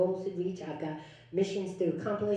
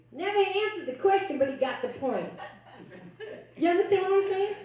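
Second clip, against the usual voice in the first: amused laugh, pitch jumping higher; 12 semitones higher than usual. Real, not acted. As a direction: laugh through it, high and bright with fun. Laughing at the story.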